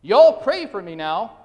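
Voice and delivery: put-on a child's loud Southern plea, slow; charming Southern accent. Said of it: "in a charming Southern accent".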